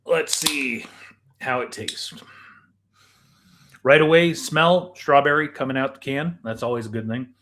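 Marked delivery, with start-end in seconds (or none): none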